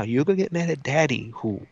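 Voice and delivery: ordinary talk, nothing marked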